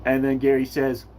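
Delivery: ordinary talk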